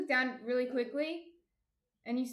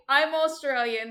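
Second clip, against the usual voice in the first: slow, louder mock Australian "I'm Australian"; mock Australian accent; 4 semitones higher, 11 dB louder than usual. Retelling a viewer's joke about Australians, mock Australian accent. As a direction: imitate an Aussie insisting on their origin in an Australian accent. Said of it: "Death Duck says, "Australians always say".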